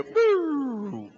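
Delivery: high, swooping siren wail; loud vocal police siren; imitated police siren. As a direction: imitate a siren with a falling hoot. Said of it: "Then I hear".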